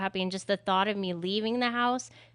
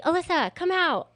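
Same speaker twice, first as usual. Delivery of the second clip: calling out; 9 semitones higher, 4 dB louder than usual. Retold or imitated. Guy calling during snake fight.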